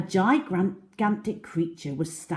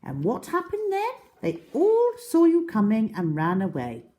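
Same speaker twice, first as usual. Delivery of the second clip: much higher, teasing; high mocking small crocodile; 6 semitones higher, 6 dB louder than usual. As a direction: rub it in. Small croc mocks big croc.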